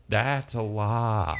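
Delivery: slow deadpan understatement. Retold or imitated. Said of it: "and I'm like".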